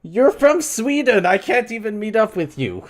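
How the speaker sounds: very high, loud exclamation; exasperated shout. Real, not acted. Streamer protests to a faraway viewer, in a high exasperated shout.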